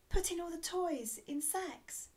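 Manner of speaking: high-pitched eager fairy guess; fairy's cry. A narrator's reading. Fairy guessing Santa's favourite job, high cry. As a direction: pipe up high like a Christmas fairy. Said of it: "cried the Christmas fairy".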